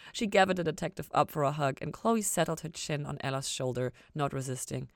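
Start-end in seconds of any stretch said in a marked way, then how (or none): none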